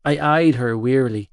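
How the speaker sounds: ordinary delivery, nothing marked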